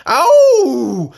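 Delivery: a high, loud yelp of pain; howl of pain. Acted. Tiger howling as fire burns his paws, loud high yelp. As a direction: howl it out as the flames bite. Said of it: "The tiger howled".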